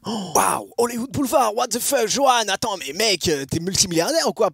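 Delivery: plus aigu, plus fort, « Hollywood Boulevard » lancé d'un ton ébahi; stupéfaction jouée. Pretend. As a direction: joue l'auditeur sidéré qui n'en revient pas. Imite la réaction choquée d'un auditeur. (surprise exagérée, voix plus haute et plus forte)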